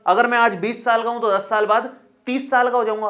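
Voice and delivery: usual speaking voice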